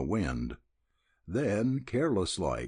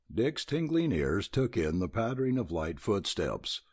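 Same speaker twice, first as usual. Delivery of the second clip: plain reading voice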